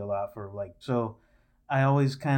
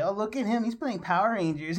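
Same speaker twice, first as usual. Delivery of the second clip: mocking kid voice; 9 semitones higher than usual. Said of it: "kids would still say".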